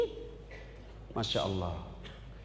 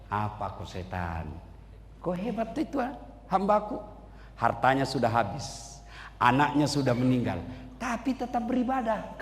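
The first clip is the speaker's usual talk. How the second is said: quiet voice of God; 9 semitones lower, 5 dB quieter than usual. Acted. God speaking softly of Ayub.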